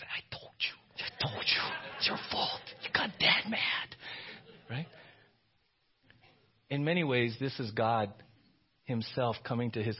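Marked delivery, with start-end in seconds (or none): 0.0-3.9 hushed blaming whisper; sibling whisper. Retold or imitated. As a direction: hiss the blame without Dad hearing. Siblings whispering blame after dad.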